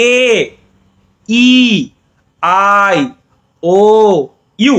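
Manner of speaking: এ ই আই ও ইউ said one at a time with pauses; teacher leading a vowel chant; vowel recitation. Shown as a demonstration. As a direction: recite এ, ই, আই, ও, ইউ slowly for the class to echo. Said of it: "উচ্চারণ করো সবাই".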